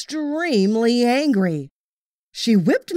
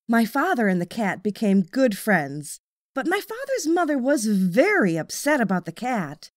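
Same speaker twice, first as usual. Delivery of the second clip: usual speaking voice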